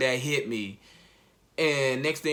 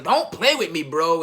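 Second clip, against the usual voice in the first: raised, slowed pleading cry; half-shouted denial of the news; loud, slow, stunned refusal; shocked disbelief; 5 dB louder than usual. Retold or imitated. Third denial to brother.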